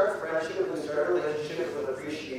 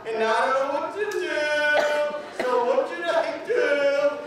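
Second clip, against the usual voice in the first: much higher, slow, near a yell; panicked girl; 9 semitones higher, slower than usual. Retold or imitated. Girl asking speaker what to do next, high and pleading. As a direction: plead for help in a high pitch. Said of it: "She's like".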